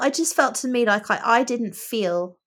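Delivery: ordinary talk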